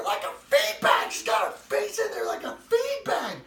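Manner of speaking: loud, high panicked yelling; retold scream. Wife screaming at the sugar-covered child. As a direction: scream it half laughing. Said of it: "and she's screaming".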